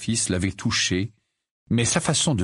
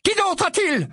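challenging mother. A narrator's reading. Mrs Wesley challenges Sirius.

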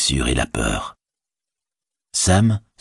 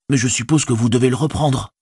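higher-pitched hobbit servant; reluctant Sam; 8 semitones higher than usual. A narrator's reading. Servant hesitating to return the burden, higher and reluctant. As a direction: offer it up in a higher voice.

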